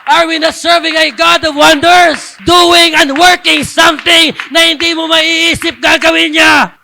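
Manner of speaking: loud, high shouted preaching; fervent pulpit shout; preaching at full force. Acted. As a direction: lift the congregation with fire. Preaching climax.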